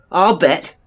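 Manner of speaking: loud, high, scornful retort; bitter disbelieving shout; angry. A narrator's reading. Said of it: "Eleanor Watkins stalked angrily away".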